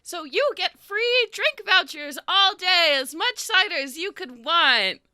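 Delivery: chirpy festival host calling the crowd in; sugary village host, high and beaming; Lila the host. Acted. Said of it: "Lila's like".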